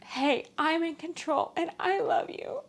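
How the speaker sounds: fighting back real tears while talking; breaking into real tears; crying. Real, not acted. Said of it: "I don't know why I'm crying now".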